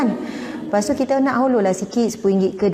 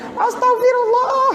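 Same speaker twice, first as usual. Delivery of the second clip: mock angry exclamation; 14 semitones higher, slower than usual. Shown as a demonstration. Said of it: "Ha, macam tu kan".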